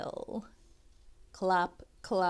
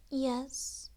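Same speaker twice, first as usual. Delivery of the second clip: squeak; 5 semitones higher than usual. A narrator's reading. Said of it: "squeaks Kitty".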